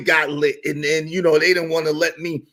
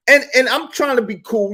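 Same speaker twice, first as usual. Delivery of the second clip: ordinary talk, nothing marked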